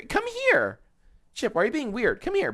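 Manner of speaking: squeaky high doggy call. Real, not acted. Owner coaxing her dog in a very high coo.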